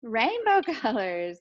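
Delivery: usual speaking voice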